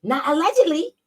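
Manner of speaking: high, put-on mocking voice; high sarcastic read of a rival's post; mocking changed voice. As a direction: squeak it out in a high, silly voice. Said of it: "not just because I changed my voice".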